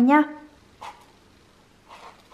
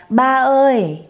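lightly voiced baby words; imitating the baby; 3 semitones higher, slower than usual. Mother repeating how her baby says papa, lightly babyish. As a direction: echo the baby's first word. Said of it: "Con nói".

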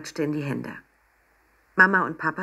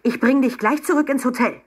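high, loud parental scolding; angry threat; 9 semitones higher, 5 dB louder than usual. A narrator's reading. Papa threatens to take the child away, angry. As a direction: threaten in rising exasperation. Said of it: "rief Papa zornig".

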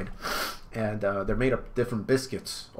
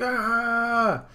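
high little gasp; a sudden high gasp; Sanji's startled high gasp; 13 semitones higher, 5 dB louder than usual. Acted. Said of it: "He's like".

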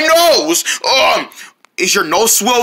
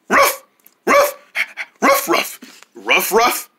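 puppy bark; much slower than usual. Acted. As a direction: yip happily like a small puppy. Puppy voiced barking.

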